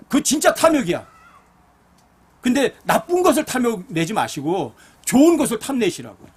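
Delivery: rising sermon appeal. Acted. Pleads about true greed.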